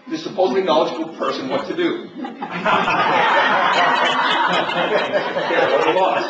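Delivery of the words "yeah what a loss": laughing out loud at his own joke; real laugh. Real, not acted. Preacher laughing at the jackass joke.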